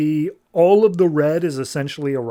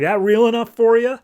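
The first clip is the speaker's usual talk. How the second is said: louder, higher mock taunt; taunting retort; 9 semitones higher, 6 dB louder than usual. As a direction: throw the line in his face, raised and loud. Retold taunt after the punch.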